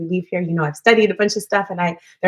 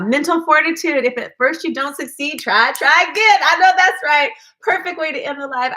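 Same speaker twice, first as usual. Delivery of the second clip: higher and louder, bouncy sign-off; upbeat cheer; 8 semitones higher, 4 dB louder than usual. Real, not acted. Ending the live with a lifted, cheering motto. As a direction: cheer them on to finish.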